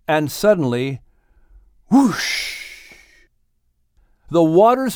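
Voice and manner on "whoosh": high burst of a whoosh; whoosh sound. A narrator's reading. Whale shooting to the surface, whooshing high. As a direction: make it burst out of the water.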